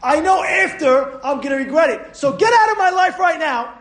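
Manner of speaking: high, loud rebuke to temptation; loud high scolding of the evil inclination; firm shout. Shown as a demonstration. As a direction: send him away for good. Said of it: "and say".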